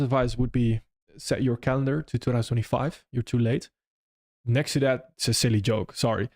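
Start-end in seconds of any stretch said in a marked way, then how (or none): none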